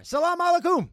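high, loud call-out; exuberant greeting. Pretend. Host greeting listeners in Iran, exuberant call-out. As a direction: sing out the greeting to faraway listeners.

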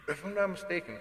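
mid-sip of beer, swallowing; while drinking a beer. Real, not acted. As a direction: talk around a swallow of beer. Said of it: "excuse me, I'm drinking a beer here".

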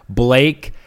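ordinary talk, nothing marked

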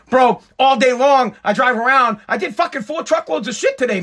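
ordinary talk, nothing marked

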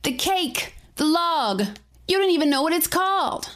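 high exasperated protest; crying out. A narrator's reading. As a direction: cry out in protest, pitched high. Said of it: "he cries out".

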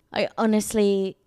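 calm, put-on British accent; measured British affectation; affected British accent. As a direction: play at sophisticated British, unhurried. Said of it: "the way I'm talking now".

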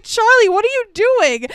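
mock panic at her husband holding an axe; alarmed cry. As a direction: squeal it like a startled wife, hammed-up. Wife sees husband with axe.

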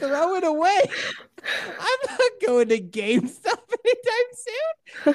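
high, laughing delivery. Real, not acted. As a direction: giggle through it. Laughing about GameStop.

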